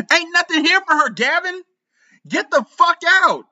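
high, furious scream; wife screams the mistress out, pitched high. A narrator's reading. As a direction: throw her out at top pitch. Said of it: "I screamed".

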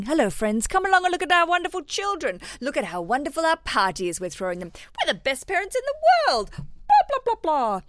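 high, showy voice of boastful parents; sing-song parody of parents bragging to their friends; mocking proud parents. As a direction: lay it on thick like smug parents showing off their kids.

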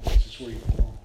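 low-pitched, firmly projected assurance; emphatic welcome. Retold or imitated. Father to returning son at his welcome home, emphatic. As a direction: land it loud and low, as a father's declaration.

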